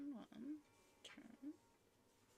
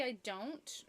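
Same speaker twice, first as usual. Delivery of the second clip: usual speaking voice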